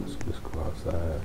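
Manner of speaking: whispered, barely voiced. Real, not acted. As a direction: whisper so the others won't hear. Other players nearby.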